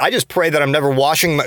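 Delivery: usual speaking voice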